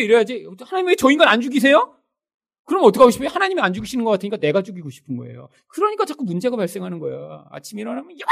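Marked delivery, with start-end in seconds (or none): 0.6-1.9 higher, louder mock-plea; exasperated complaint. Spouse complaining to God about their partner, exasperated. 8.2-8.3 sudden mock yell; angry yell. Spouse yelling at partner on waking up, angry.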